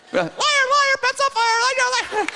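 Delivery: taunting falsetto squeak; brain's voice. Acted. Brain mocking the preacher's pretended faith, squeaky taunt. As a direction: jeer in a tiny, very high cartoon voice. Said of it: "in, in, in this voice".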